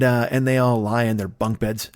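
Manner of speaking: ordinary talk, nothing marked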